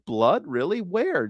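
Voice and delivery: usual speaking voice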